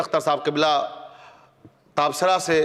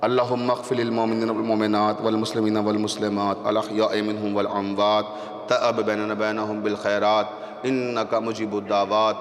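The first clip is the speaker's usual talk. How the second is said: closing prayer; 7 semitones lower than usual. Acted. Preacher closes the majlis with prayer for believers, low and hushed. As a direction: murmur the blessing to close the gathering.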